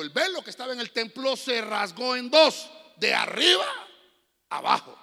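loud, high fiery preaching; preaching at full force. Acted. Pastor thundering the crucifixion scene to the congregation, loud. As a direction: preach it to the rafters.

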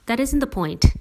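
emphatic interruption from Dad; interrupting. A narrator's reading. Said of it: "Dad interrupted".

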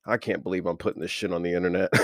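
normal talking voice

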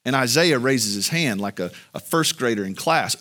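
ordinary talk

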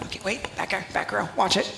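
hushed then bursting into a high loud mock exclaim; nudging a neighbour about the repeat convert; mock excited whisper. Pastor to congregation, mock gleeful aside. Mocking churchgoer saved every Christmas.

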